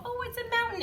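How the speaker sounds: very high sing-song mountain; delighted reveal. Shown as a demonstration. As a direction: sound amazed for a toddler. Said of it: "we just go".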